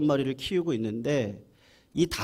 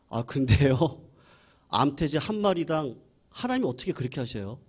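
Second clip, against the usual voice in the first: usual speaking voice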